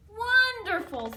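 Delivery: loud, high, drawn-out wonderful; delighted. Acted. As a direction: savour the word with delight. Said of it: "said the grocer".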